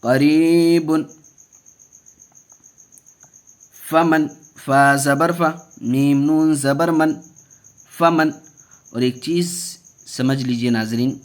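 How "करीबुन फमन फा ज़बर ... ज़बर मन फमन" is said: slow chant with long-held vowels; measured teaching recitation; Qaida spelling recitation. Shown as a demonstration. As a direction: stretch the long syllable, keep short ones brief.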